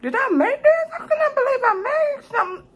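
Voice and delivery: high, mock-teary disbelief; amazed by own cooking. Real, not acted. Tasting her homemade Chinese food, high mock-crying amazement. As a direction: squeal it like happy tears.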